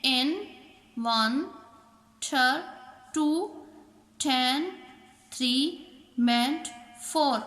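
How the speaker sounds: in, ter, टेन, मेंट each followed by its number; separate, evenly paced beats from in to मेंट, four; teacher tallying each syllable of in-ter-टेन-मेंट with pauses; counting out syllables. Shown as a demonstration. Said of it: "चार vowel sound देते हों".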